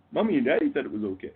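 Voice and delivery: quick, lightly put-on kid voice; mock child voice. Mocked child excusing themselves to an accuser, lightly put-on kid voice. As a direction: rattle off a childish excuse, mockingly.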